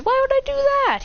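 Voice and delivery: high-pitched puzzled question; put-on high voice, surprised; taken aback. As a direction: ask it high, in disbelief. Kushina surprised by the child.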